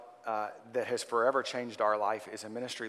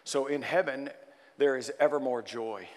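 ordinary talk, nothing marked

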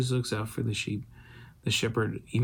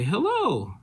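mom's hello; 9 semitones higher, 3 dB louder than usual. Retold or imitated. Mom on the phone.

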